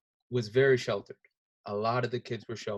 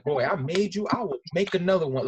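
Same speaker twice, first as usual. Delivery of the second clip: loud, high threat from a father; mock angry father shouting; stern dad yell; 7 semitones higher, 6 dB louder than usual. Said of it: "hear dads go like".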